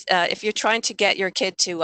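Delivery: normal talking voice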